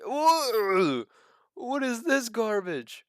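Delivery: high, slow incredulous scoff; scornful disbelief. Real, not acted. Host mocking a cheap stock's price to viewers, high slow scoff. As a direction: drag it out like it's trash.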